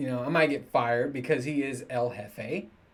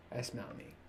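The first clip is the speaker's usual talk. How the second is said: That's not me whispered, much softer; 15 dB quieter than usual. Shown as a demonstration. Telling viewers about himself, a soft whisper. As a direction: breathe it as a voiceless whisper. Repeats line, second time whispered.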